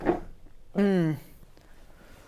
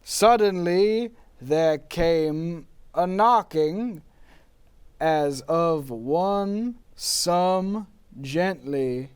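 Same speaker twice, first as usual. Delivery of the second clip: slow, hushed poetic reading; dramatic poem recital; 3 semitones higher, 4 dB louder, much slower than usual. Acted. Poe's spirit reciting his poem to the host.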